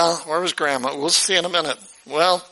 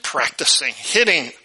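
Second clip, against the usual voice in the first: usual speaking voice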